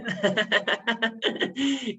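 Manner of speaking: a short amused laugh; light laugh. Real, not acted. Guest laughing at the host's congratulations, short light laugh.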